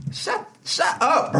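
high, slow, drawn-out mock scolding; telling Draymond to hush in a high, slow tease; mock shut up. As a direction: stretch out a teasing shut up, way up high.

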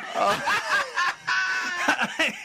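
a big, high burst of laughter; real laugh, nearly a shout. Real, not acted. Big laugh at own story.

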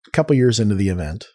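ordinary talk

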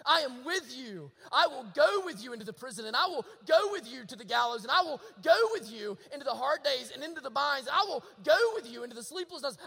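loud, driving, rhythmic sermon crescendo; forceful preacher hammering each promise; impassioned preaching. Real, not acted. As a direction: proclaim it with rising fervor.

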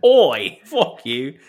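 mock British heckle with a loud opening; put-on British accent, playing a New York hooligan; British New Yorker. Riffing on co-host's slip, New York turned British accent. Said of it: "made New York British".

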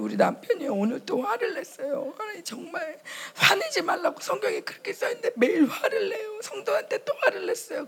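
high, laughing-crying plea to God; a wife tattling on her husband in prayer; tearful complaining prayer. Retold or imitated. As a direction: whine to God about your husband, half sobbing.

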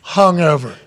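normal talking voice

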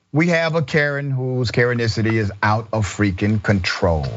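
usual speaking voice

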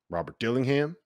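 ordinary delivery

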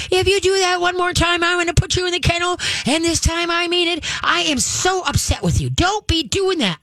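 high, fast scolding that has no bite; a hammed-up, fretful owner pleading with the dog; mock nagging woman. Trainer mimicking how women scold dogs, high and shrill-ish. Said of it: "Women, on the other hand".

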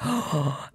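big awed gasp; sharp intake of breath, imitating amazement; retold gasp. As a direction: gasp as if just handed the part. Acting out her thrill at casting.

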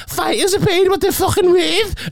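high-pitched mocking imitation; mocking quote. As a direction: parrot it back high, in ridicule. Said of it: "and then saying".

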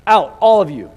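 sharp loud order to clear the room; loud royal command; commanding shout. Acted. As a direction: bark it out like a ruler. Joseph orders attendants out.

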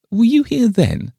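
very high, loud inquiry; surprised question. A narrator's reading. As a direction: ask with startled interest, voice leaping up. Miss Pym asks.

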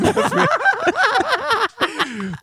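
squealing laugh way up high. Real, not acted. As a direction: shriek with laughter. Laughing at the bit.

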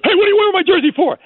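high, loud, rapid challenge; imitated yell. Shaq hollering at a fan in his jersey, yelling. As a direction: yell it out fast, high in pitch. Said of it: "he'd yell at this guy".

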